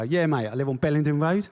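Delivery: put-on matey South London accent, laying it on. Answering the builders in a put-on South London accent. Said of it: "I go".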